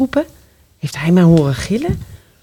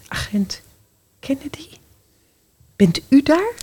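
timid whisper; 5 semitones higher, 4 dB quieter than usual. Acted. Calling out timidly.

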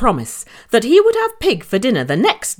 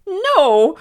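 high, louder little pig; brave shout; 10 semitones higher, 4 dB louder than usual. A narrator's reading. Gary refuses the wolf with a brave shout. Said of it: "shouted Gary bravely".

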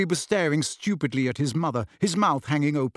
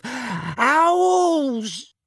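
high, loud drawn-out cry; outraged yell; 9 semitones higher, 4 dB louder than usual. Acted. Uncle interrupted mid-rant by owls, outraged yell. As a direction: bellow it out in one long high note.

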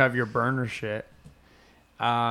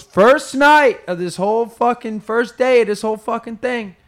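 loud, raised storyteller punch; worked-up retelling; 8 semitones higher, 10 dB louder than usual. Retold or imitated. Retelling the comedian's first night in Brazil, worked up. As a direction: kick the story up, pitched high and loud.

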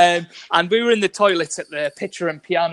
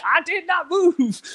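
much higher, slow, emphatic boast; John Drummond impression; 11 semitones higher, slower than usual. Teller repeats the sprinter's boast, high and slow. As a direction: drag it out like a showboating sprinter. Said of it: "He was like".

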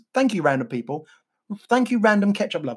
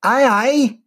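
attempted Wigan accent; 5 semitones higher, 5 dB louder, slower than usual. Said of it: "I can't do the Wigan accent".

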